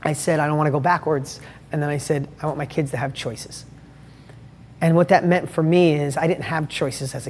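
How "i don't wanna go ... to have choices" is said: hushed and low, emotional recollection; quietly moved retelling; close to tears. Retold or imitated. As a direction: recall it softly, voice sinking with feeling. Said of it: "I wanna cry anyway".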